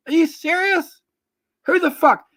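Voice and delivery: can't believe what he's hearing; disbelieving yelp at the claim; incredulous. Real, not acted. Heated argument over boxers.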